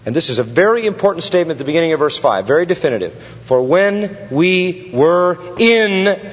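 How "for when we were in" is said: "in" drawn out and hit hard; slow, spaced words landing on a long "in"; stress on IN. Real, not acted. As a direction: underline "in" with the voice. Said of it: "underline the word in".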